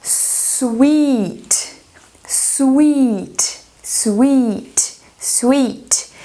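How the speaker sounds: "sweet" with a long drawn I, falling; teacher modelling "sweet" for students, long I. Shown as a demonstration. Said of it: "o double E".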